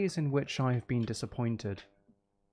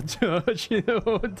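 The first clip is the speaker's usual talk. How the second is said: sudden high laugh, real amusement; high burst of laughter; 7 semitones higher, 4 dB louder than usual. Real, not acted. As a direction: break into a high giggle. Laughs at own gaffe.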